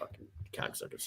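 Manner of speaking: hushed mock swearing; whispered curse. Real, not acted. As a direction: whisper the curse under breath. Joking curse after missing rocket.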